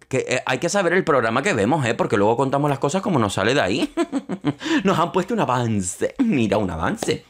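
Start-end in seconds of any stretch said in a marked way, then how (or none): 4.0-4.8 a quick high-pitched giggle; bright burst of giggling. Real, not acted. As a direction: break into a short high laugh. Laughs at own remark.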